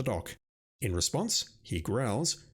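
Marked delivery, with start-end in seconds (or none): none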